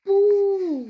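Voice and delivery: loud high boo; sudden loud boo; scare shout. Acted. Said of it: "ruft Nick in Richtung Karl".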